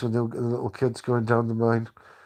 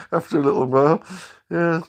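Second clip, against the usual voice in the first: louder, still laughing; 5 semitones higher, 6 dB louder than usual. Real, not acted. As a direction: talk through the laughter.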